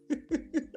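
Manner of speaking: sudden high laugh; high burst of laughter. Real, not acted. Laughing at own joke.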